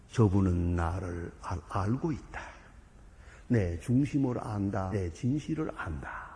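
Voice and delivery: slow, low, soft inner voice; quiet trust. Acted. Sinner thinking about the priest, slow quiet trust. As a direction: muse slowly with quiet trust.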